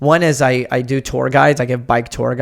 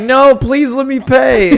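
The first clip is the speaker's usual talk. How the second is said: much higher, louder and slow, hammed-up begging; mock pleading; 11 semitones higher, 7 dB louder, slower than usual. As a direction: overplay the polite insistence. Insists on paying, retold.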